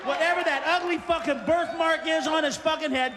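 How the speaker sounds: normal talking voice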